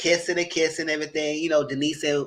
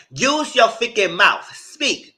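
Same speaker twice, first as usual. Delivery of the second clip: fed-up demand; 7 semitones higher, 7 dB louder, much slower than usual. Real, not acted.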